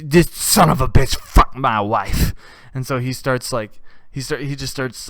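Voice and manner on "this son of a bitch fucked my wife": slow loud accusing yell. Retold or imitated. Husband yelling the accusation across the bar, slow loud. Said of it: "yelling that out at the bar".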